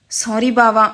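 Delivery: usual speaking voice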